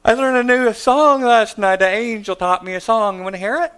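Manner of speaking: put-on high, loud eager child voice; excited little girl. Daughter eagerly offering a new song, high and loud. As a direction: bubble over like a kid with news.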